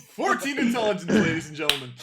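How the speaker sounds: loud, high teasing proclamation; mock announcer. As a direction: announce it to the room as a joke.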